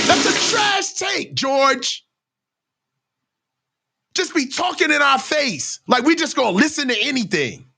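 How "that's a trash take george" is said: much higher, shouting That's a trash take; higher shouted callout; calling out. Real, not acted. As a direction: call him out with a shout. Calling out George.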